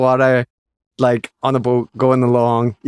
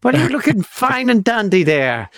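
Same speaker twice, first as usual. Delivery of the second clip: mock Irish brogue, higher; hammed-up stage Irish greeting; Irish leprechaun accent; 7 semitones higher than usual. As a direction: put on a jolly leprechaun lilt. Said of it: "little leprechaun [name]".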